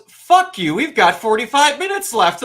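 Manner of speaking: high, loud taunt through laughter; mock the viewer gleefully; mocking Netflix. Netflix taunting the crying reviewer, loud mock voice. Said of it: "Netflix goes".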